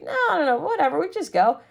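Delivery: high, airy brush-off; carefree mock teen shrug; breezy dismissive. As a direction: wave off the worry like a rebel kid. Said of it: "And I'm like".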